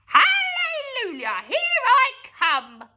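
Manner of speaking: high, loud, slow crowing cackle voice; gleeful old hag. A narrator's reading. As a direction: crow it out like a mad witch. Grandma rising triumphantly.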